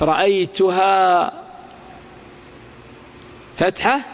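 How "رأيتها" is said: رأيتها with a long final "-haa"; pausal alif. Shown as a demonstration. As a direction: slowly draw out the last vowel of رأيتها. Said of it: "وقفت على الألف". (الوقف على رأيتها بألف ممدودة)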